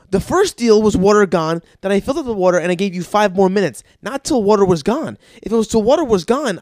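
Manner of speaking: loud, high, hammered-out argument; retold exasperated rant; exasperated scolding. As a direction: lay down the law to a kid, loudly. Uncle arguing with child, retold.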